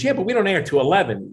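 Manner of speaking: high-pitched imitation of an easygoing host; Jay Leno, reassuring. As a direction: brush off the worry in a high voice. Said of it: "he calmed me down".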